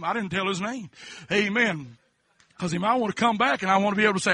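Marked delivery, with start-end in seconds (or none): none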